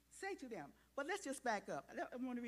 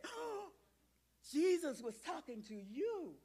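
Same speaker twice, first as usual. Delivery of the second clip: excited exclamation; 6 semitones higher than usual. Shown as a demonstration. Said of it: "I want you to say it like this".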